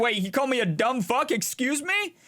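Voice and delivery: high-pitched, affronted questioning; indignant disbelief. Real, not acted. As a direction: react as if freshly insulted, pitch climbing. Insulted by game voice.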